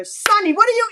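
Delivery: loud, high call across the room to the dog. Real, not acted. Owner calls out to her dog Sonny mid-story, loud high shout. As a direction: shout a sudden rebuke at the dog.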